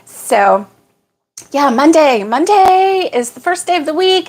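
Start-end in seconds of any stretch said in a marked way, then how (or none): 1.5-4.3 upbeat. Real, not acted. Cheering on the week.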